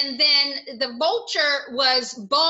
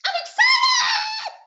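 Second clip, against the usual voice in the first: high, loud squeal of excitement; very high-pitched excited shout; 5 dB louder, slower than usual. Shown as a demonstration. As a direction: shriek it with glee, drawn out slowly. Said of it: "we did lots of emotions".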